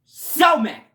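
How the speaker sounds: high, loud burst of anger; mad shout. Real, not acted. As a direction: shout it out, fully fed up. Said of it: "I'm gonna be so mad".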